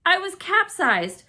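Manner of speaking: loud, high shocked cry; high, loud storybook shock; alarmed exclaim. A narrator's reading. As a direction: blurt it in alarm. Shock of capsizing.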